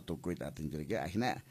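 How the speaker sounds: ordinary delivery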